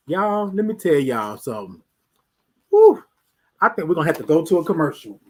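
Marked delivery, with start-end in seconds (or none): 2.7-3.0 startled whew. Real, not acted. Startled by a bug.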